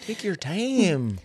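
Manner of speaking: high, slow Minnesota accent imitation. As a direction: stretch it out in a mock Minnesota accent. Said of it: "version of the accent".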